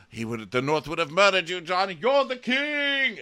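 hammed-up urgent insistence; impassioned proclamation. Sam telling John he is king, impassioned.

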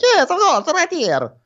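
very high, loud put-on voice; hammed-up high, excited imitation; mock eager suitor. Imitating his reply about the date, high eager voice.

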